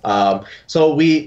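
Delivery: normal talking voice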